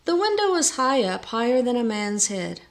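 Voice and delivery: ordinary delivery, nothing marked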